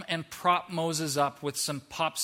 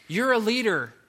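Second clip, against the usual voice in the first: louder and higher, forcing himself to believe it; forced loud affirmation; 5 semitones higher, 6 dB louder than usual. Pretend. Moses trying to convince himself, forced loud affirmation. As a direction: push it out to the mirror. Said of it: "Moses standing in front of a mirror".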